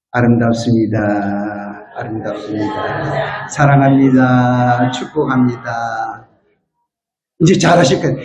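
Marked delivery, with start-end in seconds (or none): 0.1-6.3 tender greeting. Shown as a demonstration. Congregation greeting the person beside them, slow and tender. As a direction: lower and gentle, words drawn out. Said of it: "옆 사람하고 인사합시다".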